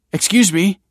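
high, loud call for attention; hailing. A narrator's reading. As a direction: call out to a passer-by. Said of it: "hailed Michael".

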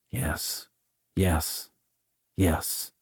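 low muttering, barely audible; muttered. A narrator's reading. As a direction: mumble it under your breath, low. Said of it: "muttered the others".

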